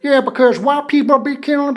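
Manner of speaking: high, put-on Southern accent.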